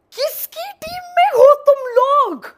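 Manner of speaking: high, loud indignant yell; mock outraged shout. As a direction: yell at the traitor friend. Outraged at friend's betrayal. (दोस्त पर ऊँची आवाज़ में नकली गुस्सा)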